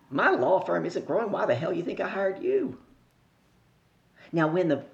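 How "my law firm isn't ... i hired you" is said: raised, incredulous retort; scoffing disbelief. Retold or imitated. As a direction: throw it back as if it's absurd. Client scoffs at coach's statement.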